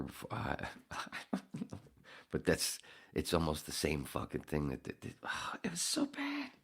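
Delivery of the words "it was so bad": 1 exasperated. Real, not acted. Exasperated, very high squeak.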